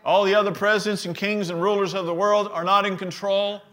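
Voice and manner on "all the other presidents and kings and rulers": raised preaching voice, driving each phrase; preaching with force. Real, not acted. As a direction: preach it out to the congregation. Fiery sermon, congregation responding.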